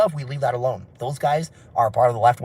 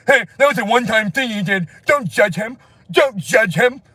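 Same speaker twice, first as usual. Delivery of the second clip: higher, pleading protest; mock indignant defence; 9 semitones higher, 4 dB louder than usual. Followers excusing their leader's past, high mock-indignant pleading. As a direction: protest on his behalf, hammed-up.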